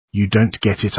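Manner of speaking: ordinary delivery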